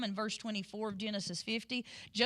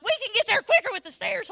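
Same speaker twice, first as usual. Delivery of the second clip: higher, louder, fast eager push; eager husband; 11 semitones higher, 9 dB louder, faster than usual. Retold or imitated. Husband urges wife up the cruise ship stairs, loud and eager. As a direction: cheerfully urge her up the stairs, quick and loud. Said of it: "He's like".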